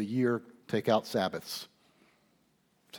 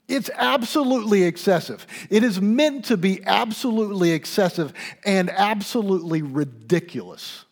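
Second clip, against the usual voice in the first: much higher and louder, driving the point home; impassioned preaching; 10 semitones higher, 8 dB louder than usual. Real, not acted. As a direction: build to a full-force pulpit climax. Preacher's climax on extravagance.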